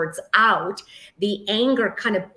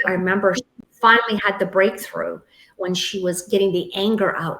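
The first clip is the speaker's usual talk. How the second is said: usual speaking voice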